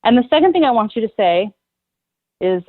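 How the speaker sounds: ordinary talk, nothing marked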